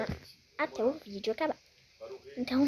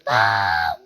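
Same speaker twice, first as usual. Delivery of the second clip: deep startled shout; 10 dB louder than usual. Real, not acted. Falls again.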